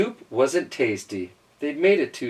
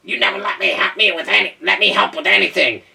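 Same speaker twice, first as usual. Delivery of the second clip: wailing duck; 7 semitones higher, 6 dB louder, faster than usual. A narrator's reading. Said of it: "wailed the duck".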